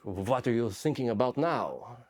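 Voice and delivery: slow mock French accent, rising at the end; Olivier Sarbil, French accent. French cameraman pestering the soldier he films, French accent. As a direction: ask it slowly as an imitated Frenchman. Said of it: "almost like Pepe Le Pew".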